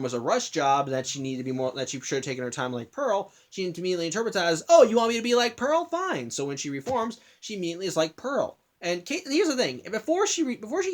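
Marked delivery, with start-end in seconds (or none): 4.7-6.3 quick, louder and higher, a sarcastic snap back; indignant retort. Retold or imitated. Amethyst answering Garnet's criticism, sarcastic and indignant. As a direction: throw the criticism back in her face.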